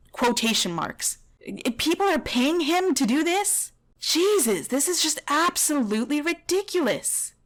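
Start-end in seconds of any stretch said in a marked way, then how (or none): 1.8-7.3 high, exasperated outburst; real indignant incredulity; outraged disbelief. Real, not acted. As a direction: raise the pitch in sheer disbelief. Outraged at the trainer.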